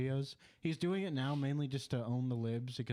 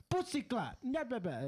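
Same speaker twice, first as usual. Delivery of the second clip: mock Jamaican; 11 semitones higher than usual. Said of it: "the way he defended it was like".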